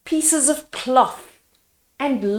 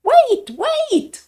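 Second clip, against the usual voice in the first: higher, louder breathless call; out of breath; 6 dB louder than usual. A narrator's reading. Villager running to Mandy, huffing. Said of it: "one huffed".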